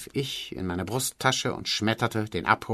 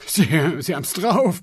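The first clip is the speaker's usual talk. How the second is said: panting; 7 semitones higher, 4 dB louder than usual. Acted. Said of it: "keuchte Sensenbrink".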